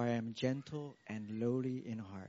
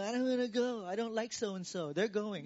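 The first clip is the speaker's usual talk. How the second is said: mock whiny complaint; 7 semitones higher than usual.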